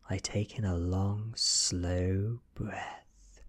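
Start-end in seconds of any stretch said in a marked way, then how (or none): none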